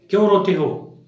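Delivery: put-on loud demanding cry to silence the kids; shouting. A narrator's reading. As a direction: shout over the crying children. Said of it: "रात रानी चिल्लाती है".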